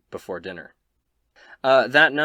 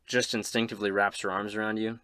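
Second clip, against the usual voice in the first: usual speaking voice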